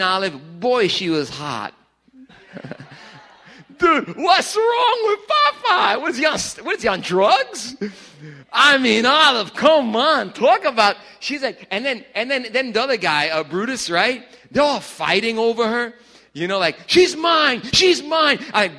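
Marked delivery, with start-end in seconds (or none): 3.8-7.0 incredulous. Real, not acted. Disbelief at Popeye ignoring Olive. 16.9-18.4 high, loud shout of a jealous cartoon rival; a hammed-up cartoon brawl cry; mock shouting rivals. Popeye and Brutus fighting over Olive.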